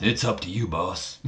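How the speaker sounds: badly faked wrestler's accent, soft and trailing off; Booker T accent. Wrestler deferring to his boss, in a bad Booker T accent. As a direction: do a lightly put-on, horrible Booker T accent. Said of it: "In my horrible Booker T accent".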